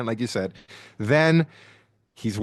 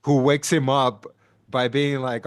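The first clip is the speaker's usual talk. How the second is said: ordinary talk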